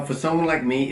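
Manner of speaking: usual speaking voice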